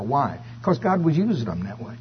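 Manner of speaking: ordinary delivery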